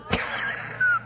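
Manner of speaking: high breathy laugh at the punchline; burst of laughter. Real, not acted. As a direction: laugh out at the milk dud joke. Punchline of Dave's joke.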